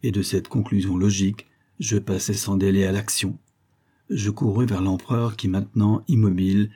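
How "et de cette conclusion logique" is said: ordinary delivery, nothing marked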